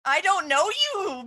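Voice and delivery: normal talking voice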